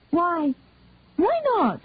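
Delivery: sharp, high-pitched, pointed questions; sharp retort. A narrator's reading. Daughter challenging her adoptive parents, sharp and high. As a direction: snap back at her parents, voice jumping up. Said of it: "uncharacteristically sharp sound".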